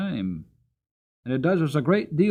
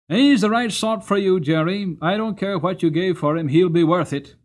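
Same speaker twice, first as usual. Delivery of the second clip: a loud, higher, approving Grant; hearty old cabman; 6 semitones higher, 8 dB louder than usual. Acted. Governor Grant approving Jerry's new horse, loud and hearty. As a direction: give the verdict like the boss of the stand.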